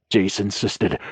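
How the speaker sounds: ordinary delivery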